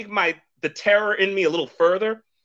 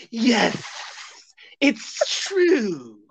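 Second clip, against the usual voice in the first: drawn-out hissing S's, higher voice; hissing snake; 6 semitones higher, much slower than usual. Pretend. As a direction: answer like a cartoon snake. Playing the talking snake.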